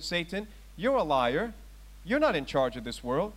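usual speaking voice

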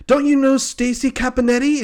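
high, loud, desperate question; frantic questioning. Acted. Man frantically questions the bartender.

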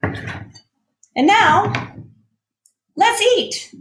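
high, bright call to start eating; sing-song excitement. Acted. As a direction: announce the meal like a treat.

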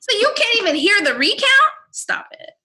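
loud, high, exasperated pushback; loud, high, fed-up rant; heated retort. Retold or imitated. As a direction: push back hard and refuse to back down. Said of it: "and I'm like".

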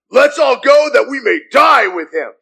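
rally them with a shout; rallying cry. Acted. Thomas urging the disciples to go and die, rousing shout.

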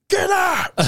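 high-pitched mock shout; drunk teacher yelling. Drunk English teacher throwing a student out, mock yelling. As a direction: imitate a drunk teacher's sudden yell. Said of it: "He just started yelling".

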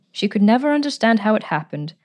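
ordinary delivery, nothing marked

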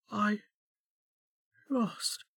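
faint, high, broken by a long pause; fading anguish. A narrator's reading. Anguished woman losing consciousness, faint and fading. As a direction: barely get the words out as you slip away.

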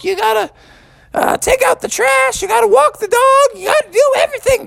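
very high shrill-free scolding mother call; high mock mother yelling chores; hollering mom. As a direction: holler from the kitchen at her son. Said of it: "mom was hollering out from the kitchen".

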